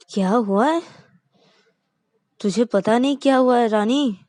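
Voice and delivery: loud, furious threats; an angry wife swears she won't spare him; angry threatening. Acted. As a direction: vow revenge loudly.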